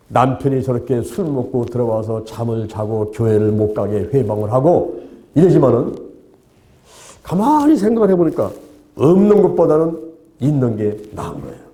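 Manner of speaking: ordinary delivery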